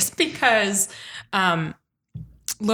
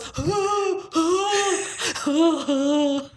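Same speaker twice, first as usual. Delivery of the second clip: high, drawn-out mock gasps; hammed-up trance gasps; exaggerated gasping; 6 semitones higher than usual. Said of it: "Claire goes".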